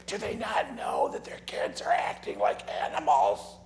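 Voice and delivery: a loud hiss of gossip meant to be overheard; loud stage whisper. Retold or imitated. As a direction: whisper it so the whole room hears. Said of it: "they're whispering this loud".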